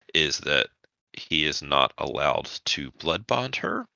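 ordinary delivery

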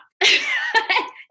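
loud high laugh; loud, high-pitched laugh; high burst of laughter. Real, not acted. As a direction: laugh out at yourself. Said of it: "I need a nap".

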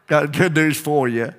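folksy Appalachian twang; hillbilly accent. Acted. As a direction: say it like a country preacher. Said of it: "Talking like a hillbilly now".

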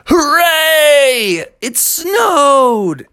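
high, drawn-out cheer; excited shout. Acted. Froggy cheers at the first snow, high excited shout. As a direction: shout it out in glee. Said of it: "So he shouted".

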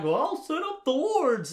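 high, loud mock character voice; hammed-up oblivious volunteering; Ron. Imitating a film character offering to set wards, hammed-up. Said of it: "Ron's like".

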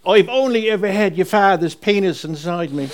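Mum's blunt remark said high and loud; mock loud. Son retelling Mum's loud theatre remark, mock loud. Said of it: "said quite loudly".

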